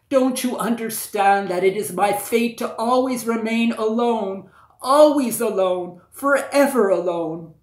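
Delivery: high and loud, pleading; anguished cry. Acted. Princess crying down to the prince about her lonely fate, loud lament. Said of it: "cried out the princess".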